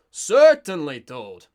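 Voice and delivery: loud burst on Certainly, dropping away on Toad; projected imitation. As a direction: project hard in an over-emphasized mock narrator's character voice. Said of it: "trying to kind of emphasize the projection".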